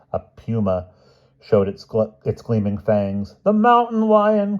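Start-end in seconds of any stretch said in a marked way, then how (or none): 3.4-4.6 very high, frightened quaver; hammed-up high-pitched terror; quavering Hyde. A narrator's reading. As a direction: quaver it at the top of your range. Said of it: "quavered Hyde".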